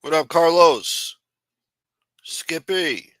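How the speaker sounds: slow, loud call to Carlos; drawn-out loud greeting. Real, not acted. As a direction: stretch the greeting out like a cheer. Shouting out a viewer.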